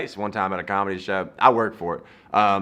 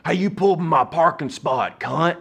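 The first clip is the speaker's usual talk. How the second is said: higher-pitched imitation of an irate stranger; angry yelling; 7 semitones higher than usual. Angry stranger confronts driver over a parking spot, yelling. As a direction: yell it like a parking-lot hothead. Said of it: "there's a guy behind us yelling at you".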